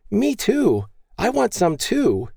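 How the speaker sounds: put-on high, slow toddler's demand; little boy shout. A narrator's reading. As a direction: call out like a four-year-old. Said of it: "four-year-old Emil impulsively shouted".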